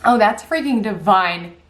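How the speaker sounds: ordinary delivery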